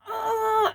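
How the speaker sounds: put-on high, strained child's cry; mock child's distressed "oh"; imitating a child's anguished wail. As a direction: act the girl starting to break down. Child about to burst into tears.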